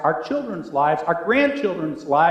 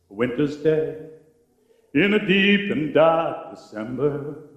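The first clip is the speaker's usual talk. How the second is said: slow, hushed recitation of lyrics, partly sung; recited song lyric; 4 semitones lower than usual. Acted. Let the lines drift out low and dreamy.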